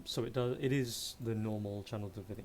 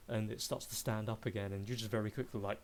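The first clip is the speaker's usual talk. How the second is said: usual speaking voice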